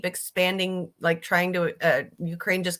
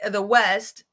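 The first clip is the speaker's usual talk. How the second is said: ordinary talk, nothing marked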